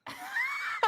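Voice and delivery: high laughing outburst; a sudden squealing laugh; burst of laughter. Real, not acted. Reacts to cat on keyboard.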